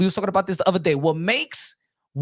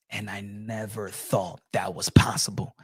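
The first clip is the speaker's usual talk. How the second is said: hushed whisper of disbelief; whispered aside; 10 semitones lower, 5 dB quieter than usual. Real, not acted. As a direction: drop to a whisper as if confessing. Amazed aside about the chin.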